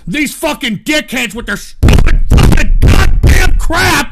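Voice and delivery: drawn-out screaming insult; furious slow yell. Real, not acted. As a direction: spit out every word in rage. Ranting at chat, furious.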